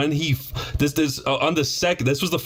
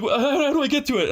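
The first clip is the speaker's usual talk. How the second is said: high mock gasping alarm; shocked plumber; 13 semitones higher than usual. Old plumber seeing the flooded bathroom, high shocked voice. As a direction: squeal it like a horrified old plumber.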